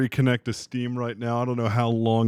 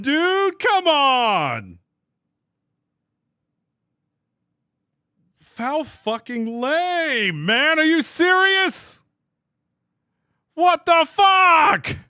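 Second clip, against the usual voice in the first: high, drawn-out whiny outrage; real anger stretching every word; frustrated ranting; 4 dB louder, slower than usual. Real, not acted. Streamer vents to chat over the lost fight, high and drawn-out. Disconnected from Steam mid-battle.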